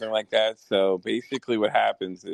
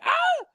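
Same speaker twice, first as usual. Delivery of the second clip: mock shriek far higher, like a startled little girl; little-girl scream; 3 dB louder than usual. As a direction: squeal in fake terror. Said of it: "he screams like a little girl".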